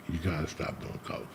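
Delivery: soft unvoiced whisper, very quiet; whispered warning. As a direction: hush it as a last plea. Repeats dying friend's warning.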